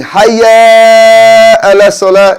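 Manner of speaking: hayya ala salah drawn out slowly, loud and high; wrong long hayya. Shown as a demonstration. Teacher mimics the wrong drawn-out hayya. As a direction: stretch hayya too long. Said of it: "that's wrong".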